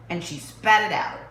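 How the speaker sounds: ordinary delivery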